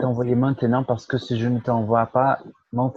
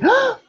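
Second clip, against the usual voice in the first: high mock cry. Shown as a demonstration. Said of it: "mimicking the video".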